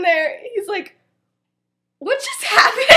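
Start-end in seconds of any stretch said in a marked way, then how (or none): 2.0-3.0 laughing shout. Retold or imitated. Stunned after call in story.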